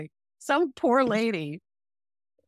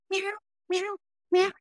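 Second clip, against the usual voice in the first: kitten meow; 11 semitones higher than usual. Retold or imitated. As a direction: mew like a tiny lost kitten. Imitating the trapped kitten.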